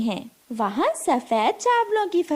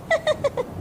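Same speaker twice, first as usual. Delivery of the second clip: high gloating cackle; a crow laughing at the birds' bad luck; wicked cackle; 14 semitones higher than usual. A narrator's reading. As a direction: cackle with glee at their trouble. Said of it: "और हंसने लगती है".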